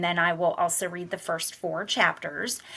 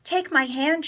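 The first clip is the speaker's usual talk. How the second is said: higher, light sisterly voice; older sister coaxing; 9 semitones higher than usual. Acted. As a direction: coax her little sister along. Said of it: "she says".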